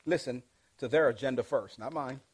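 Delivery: ordinary talk, nothing marked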